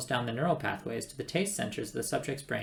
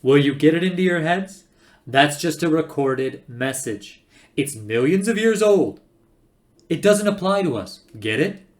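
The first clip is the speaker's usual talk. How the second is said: loud, high, emphatic insistence; exasperated shouting; 4 semitones higher, 11 dB louder than usual. Acted. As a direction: hammer it into their heads. Zaphod shouts at his companions.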